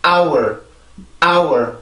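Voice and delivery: "hour" as a falling "ow" gliding into R; pronunciation demo. Shown as a demonstration. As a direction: model "hour" as isolated, careful "ow-er". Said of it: "여기까지 발음하면 무슨 발음이에요". ("hour"를 '아우얼'로 또박또박 발음)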